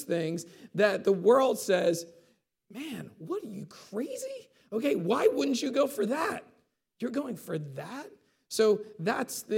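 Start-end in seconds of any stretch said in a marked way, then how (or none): 2.7-8.1 mocking worldly voice. The world scoffing at a believer, incredulous mock. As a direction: pitch leaps up on crazy, a scoffing friend.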